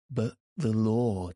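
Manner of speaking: usual speaking voice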